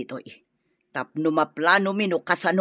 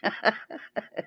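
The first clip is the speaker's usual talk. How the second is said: sly soft laugh; 2 semitones higher, 9 dB quieter than usual. Acted. Mother laughs at daughter's scheme.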